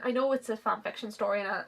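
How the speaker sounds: ordinary delivery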